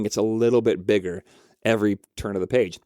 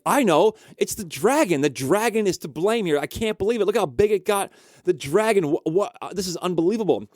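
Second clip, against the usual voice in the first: put-on high, exasperated kid voice; acted kid's outburst; 7 semitones higher than usual. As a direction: plead like an incredulous child, pitched up high. Kid excitedly blames the dragon.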